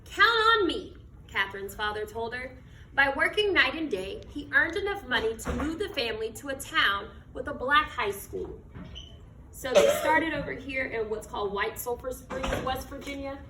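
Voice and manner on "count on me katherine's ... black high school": slow, expressive picture-book reading; reading to children. Acted. Teacher reading a picture book to her class, storytelling voice. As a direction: read it like storytime on the rug.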